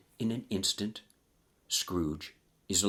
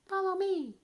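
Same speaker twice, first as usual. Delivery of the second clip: put-on tiny young lad. A young ghost beckons Scrooge to follow, tiny high lad's voice. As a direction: pipe it up high like a tiny boy. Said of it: "says this tiny young lad".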